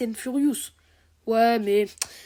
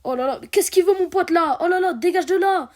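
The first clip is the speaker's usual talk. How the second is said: loud, high shout to shoo someone off; annoyed shouting; 7 semitones higher, 8 dB louder than usual. Pretend. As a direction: yell at them to clear off. Shooing an intruder from the door.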